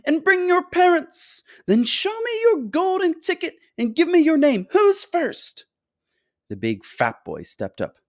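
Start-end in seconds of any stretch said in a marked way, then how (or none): 0.0-5.7 very high, piping instructions; excited, high-pitched host; high fluty calling. A narrator's reading. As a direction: call out brightly in a high, fluty voice. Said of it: "he called out".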